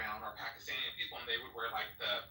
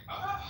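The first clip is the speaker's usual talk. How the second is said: high, frustrated groan; exasperated ugh; 5 dB louder than usual. Retold or imitated. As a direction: let out a fed-up ugh. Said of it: "it just became like".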